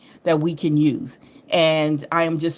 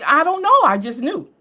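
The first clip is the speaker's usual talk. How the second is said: breezy reply; 8 semitones higher than usual. Retold or imitated. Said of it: "her own breezy reply".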